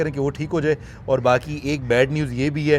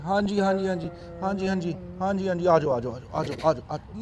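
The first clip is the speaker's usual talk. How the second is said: soft, higher cooing to the cub; softer high coo to the little lion Hercules; 4 semitones higher, 4 dB quieter than usual. Real, not acted. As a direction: coax him gently, softly.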